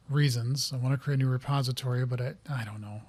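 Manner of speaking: ordinary delivery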